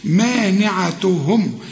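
مانعتهم said slowly with a distinct "ni" syllable; clear noon. Shown as a demonstration. Teacher showing the noon, مانعتهم slow and distinct. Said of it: "والنون هنا لازم تظهرها".

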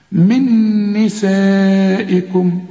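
من نسائكم with the ن held long and nasal; ghunna recitation. Shown as a demonstration. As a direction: hum the doubled ن in من slowly.

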